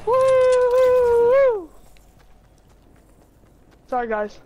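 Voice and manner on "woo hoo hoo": long, high, very loud whoop. Real, not acted. Player celebrating too loudly mid-game, whooping. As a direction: hold a high celebratory woo-hoo. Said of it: "Got too loud".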